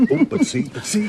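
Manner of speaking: laughing. Acted. Laughing, spotting Malvolio coming.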